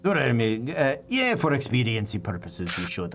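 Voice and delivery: hesitant Scottish dwarf, conceding; Scottish dwarf voice, dropping off at the end; Scottish accent. Acted. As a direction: give in with a Scottish brogue. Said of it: "the Scottish in me".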